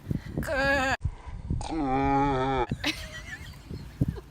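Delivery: low, drawn-out goat bleat. Pretend. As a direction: bleat like a ram, deep and long. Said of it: "That was the worst goat impression".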